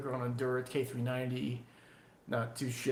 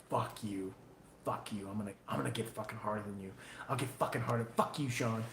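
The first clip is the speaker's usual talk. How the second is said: lower, softer grumbling rivals; mock rival makers; 5 semitones lower, 7 dB quieter, faster than usual. Maker voicing rival bladesmiths muttering back at him, low and soft. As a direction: grumble like jealous competitors. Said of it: "watching that are like".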